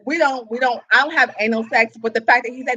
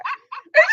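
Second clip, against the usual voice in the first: high shrieking laugh. Real, not acted. Bursts out laughing.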